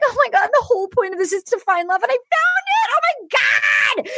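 high, fast, breathless mock rapture; hammed-up high gushing; overjoyed Claire. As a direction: rush it out like she's found true love. Claire voiced overjoyed at finding love.

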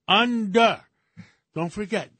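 ordinary talk, nothing marked

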